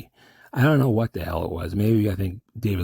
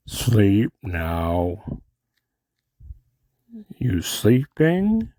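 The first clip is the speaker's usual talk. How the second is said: kooky doctor; much slower than usual. Acted. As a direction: stretch the words, swoop up at the end. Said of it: "that one kooky doctor in the prison".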